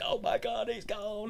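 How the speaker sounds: high, soft mock sob; imitating her tearful wail, lightly; a teasing, hammed-up little weep; mock crying. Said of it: "and she's crying".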